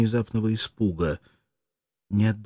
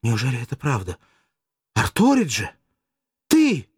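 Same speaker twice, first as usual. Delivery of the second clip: climbing much higher and louder to a cry on "Ты"; joyful cry; 4 semitones higher, 6 dB louder than usual. Acted. Mirdza recognizes her living lover, crying out. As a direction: disbelief turning into joy. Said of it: "вскричала со внезапной радостью".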